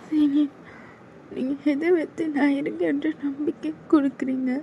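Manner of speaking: put-on timid young woman begging him to stop; scared junior girl. Junior girl begging her senior to back off, high and timid.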